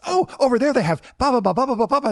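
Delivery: chirpy rehearsed guest-pitch patter; coworker's spiel. Retold or imitated. Coworker jumping in to pitch food, high chirpy voice. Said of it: "and she goes".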